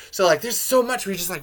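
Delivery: ordinary talk, nothing marked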